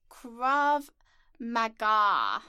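Krav Maga said slowly, each syllable clear; careful pronunciation. Shown as a demonstration. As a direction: spell out Krav Maga deliberately. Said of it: "it is pronounced".

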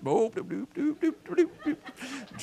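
playful doop doop doop, rising high; sing-song strut noises for a friend walking in; strutting sound effect. Retold or imitated. Pastor mimicking friend's strut, playful sing-song. Imitating his strutting walk.

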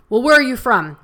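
retelling a rude guard's interrogation, loud and high; mock angry guard. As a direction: snap the question like a rude border guard.

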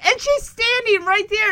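ordinary delivery

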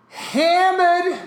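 emphatic hammered; stress on hammered. Retold or imitated. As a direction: hit hammered as the punchline. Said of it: "I was like, Yo".

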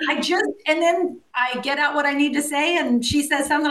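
ordinary delivery

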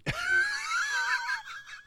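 high screaming laugh; shrieking laugh. Real, not acted. Bursts out at the joke.

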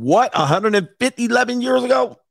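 high, rising incredulous tone; mock disbelief. Baffled at old history, high mock disbelief. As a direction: sound baffled at the date.

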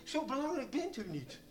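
a hint of a self-important preacher, laughing; mock the pastor's pride while chuckling; pompous pastor. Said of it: "Hij zei". (lichtjes spottend als verwaande voorganger)

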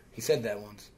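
ordinary delivery

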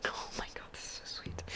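unvoiced moved whisper; hushed awed whisper. Real, not acted. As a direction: whisper it, overcome by sweetness.

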